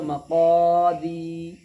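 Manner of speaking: slow मक़ादी, क़ा and दी held long; joined madd recitation. Shown as a demonstration. Teacher joining syllables for pupils, sustained recitation. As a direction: stretch both long vowels in मक़ादी. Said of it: "यहाँ पर मिलाएंगे".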